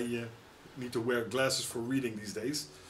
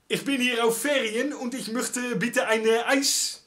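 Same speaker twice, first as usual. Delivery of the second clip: put-on high, thick Dutch accent in clumsy German; Dutch tourist German; 7 semitones higher, 4 dB louder than usual. Mocking Dutch tourists speaking German, in a hammed-up Dutch accent. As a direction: ham up a Dutch tourist ordering in German, higher. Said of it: "Otherwise you sound like some, uh, Dutch tourist".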